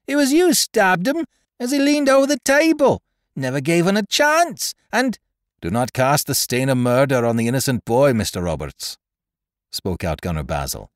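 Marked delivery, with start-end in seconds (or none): 0.1-5.2 high, loud, furious accusation; raging boy. A narrator's reading. Furious boy accusing George of the stabbing, shrill rage. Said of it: "flying into a fury of rage". 5.6-9.0 a touch of pious sermonizing; lightly voiced sanctimonious gunner; preaching manner. A narrator's reading. Gunner defending the boy with mock piety, lightly preachy. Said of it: "in his best preaching manner".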